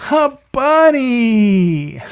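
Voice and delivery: very slow, high bunny; high drawn-out voice. Acted. PJ finally wants to be a bunny, slow and high.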